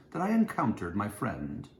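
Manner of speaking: ordinary delivery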